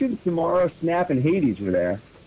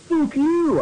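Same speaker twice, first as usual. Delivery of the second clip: high-pitched read-aloud curse; cursing retort; 12 semitones higher than usual. A narrator's reading. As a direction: snap it out high, lightly hammed up. Fanfic heroine curses woman behind her.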